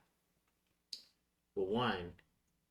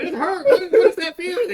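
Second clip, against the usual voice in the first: high, loud, laughing cry of pain; laugh-cry at the hurt; 17 dB louder, faster than usual. Retold or imitated. [name] pulling a muscle in practice, high laughing cry. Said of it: "I was like".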